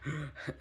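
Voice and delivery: sudden gasp for air; startled gasp on waking; sharp gasp. Real, not acted. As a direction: gasp as if jolted awake. Waking from the nightmare.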